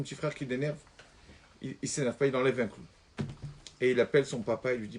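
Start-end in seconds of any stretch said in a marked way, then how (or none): none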